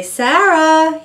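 high, loud parrot call of a name; mimicking a talking parrot calling out. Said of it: "Max would say".

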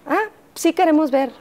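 agudo, imitando al funcionario burlón. Retold or imitated. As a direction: remeda al funcionario, alto y burlón. Funcionario retado, voz imitada. (el funcionario retándola, imitado agudo y burlón)

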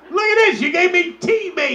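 high, loud outraged accusation; mock horrified shriek. Man accusing his lover back, mock shrieking.

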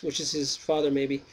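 ordinary delivery, nothing marked

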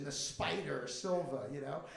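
usual speaking voice

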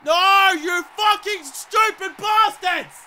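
drawn-out, high angry yell; furious shout. Real, not acted. Gamer raging at his players over bad play, furious shout. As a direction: bellow at your useless teammates.

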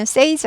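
says with a short "e" vowel, like "sez"; says pronounced SEZ. Shown as a demonstration. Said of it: "A에서 에 발음으로 바뀝니다". (says를 "세즈"처럼 짧은 에 발음으로)

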